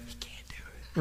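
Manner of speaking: a secret whispered to the room; whispered aside. Acted. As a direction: lean in and whisper it low. Aside on the friend's doubt.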